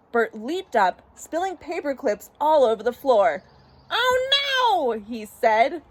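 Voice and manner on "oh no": high, drawn-out cry of dismay; alarmed. Acted. Bert hears the tub is overflowing, high alarmed cry. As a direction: wail it in a very high voice.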